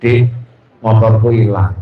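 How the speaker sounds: low, mopey husband voice; sorry husband. Acted. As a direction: sulk to a sympathetic friend. Husband complains to old schoolmate.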